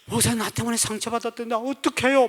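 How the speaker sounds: high, soft voice of someone shaken; trembling alarm. Retold or imitated. Deaconess fretting to pastor she hurt someone, trembling alarm. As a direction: imitate a frightened, fretful plea. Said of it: "막 벌벌 떨면서".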